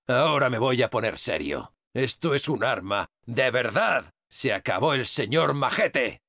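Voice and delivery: low, serious threat; menacing. Acted. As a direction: drop the voice low and mean business. Menacing boast, getting serious.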